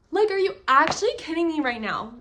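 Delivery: high, loud gushing disbelief; excited disbelief. Real, not acted. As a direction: gush like the quote floored you. Gushing over a favourite quote.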